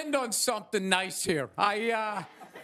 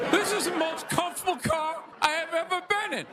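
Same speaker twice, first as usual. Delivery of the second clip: mock Shaq car ad; 4 semitones higher than usual. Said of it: "the mid-sized Buick Century".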